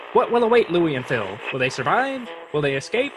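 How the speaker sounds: dramatic trailer narrator; previously on recap. Acted. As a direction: read it like a TV recap.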